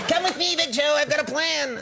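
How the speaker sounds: high squeaky sidekick voice; puppet voice. Retold or imitated. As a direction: pitch way up like a little puppet. Imitating Norbert puppet voice.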